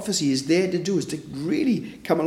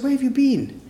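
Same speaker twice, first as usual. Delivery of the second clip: a mother's sharply raised question; mum's high question; 10 semitones higher than usual. Retold or imitated. Mum asks her daughter where she's been, voice raised high. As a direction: lift it high like a worried mum.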